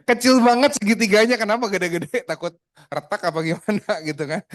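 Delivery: high and loud, laughing at the tiny triangle; amused, high-pitched laughing teasing; laughing disbelief. Real, not acted. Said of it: "kenapa lu ceritanya kayak gini".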